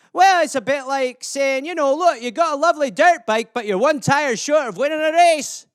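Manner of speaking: hammed-up Scottish lilt; Scottish accent. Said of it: "it's a Scottish euphemism".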